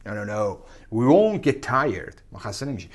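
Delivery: ordinary talk, nothing marked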